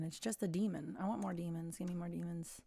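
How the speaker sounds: ordinary delivery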